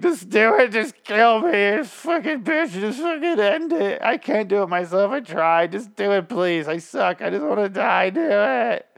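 high, wailing mock sob, pleading to die; Negan pathetic begging. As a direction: beg and wail like a broken man. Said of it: "gets really sad and pathetic".

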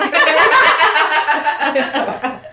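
high, loud burst of laughter; laughing. Real, not acted. Storyteller cracking up after quoting Zeke, real laughter. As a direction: crack up at your own story. Laughing at own retold moment.

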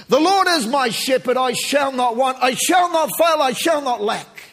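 high, loud proclaiming of the psalm; triumphant preacher's cry; shouted declaration. Real, not acted. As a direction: proclaim it with hammering emphasis on shall.

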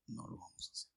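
the youths quietly refusing the king; whispered refusal. Retold or imitated.

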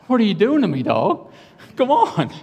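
high, exasperated plea to a dog; dismayed pleading. Retold or imitated. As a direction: plead in acted disbelief.

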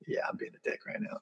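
hushed, voiceless aside; sheepish admission. Retold or imitated. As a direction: admit it under your breath. Retells sheepish realization to himself.

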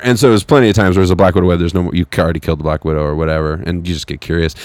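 usual speaking voice

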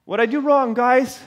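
mock hurt question; pleading confusion, hammed-up; bewildered Uriah. Uriah asks the soldiers, bewildered and loud.

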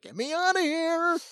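high mock shriek of fear; hammed-up terrified cry; mock panic. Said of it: "and you're like".

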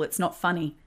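ordinary talk, nothing marked

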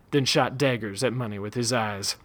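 plain reading voice

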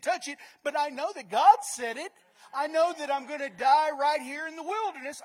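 loud, rising preacher's cry of faith; faith shout. Acted. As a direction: shout it like a believer stepping out. Voicing a faithful believer.

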